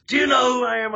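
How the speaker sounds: high, snooty mimic of a self-important jerk; mock the entitled guy's line; arrogant quote. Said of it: "that person that says".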